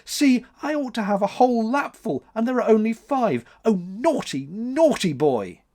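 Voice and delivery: high tearful scolding; weeping. A narrator's reading. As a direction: lament and reproach. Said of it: "wept June".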